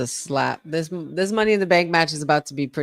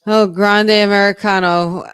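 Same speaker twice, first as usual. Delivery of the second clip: loud mocking call; 3 semitones higher, 9 dB louder than usual. Real, not acted. Mocking wrestler's alias.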